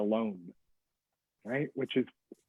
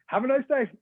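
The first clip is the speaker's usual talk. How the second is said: drive-through server; 10 semitones higher than usual. Acted. Someone handing food out the window, chirpy server voice. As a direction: send it out high like a server at the window.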